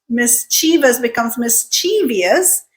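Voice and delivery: mischievous as mis-CHEE-vus, then mis-CHEE-vee-us; drifting mispronunciation. Shown as a demonstration. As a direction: slowly add an extra EE to mischievous. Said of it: "So then we do something else".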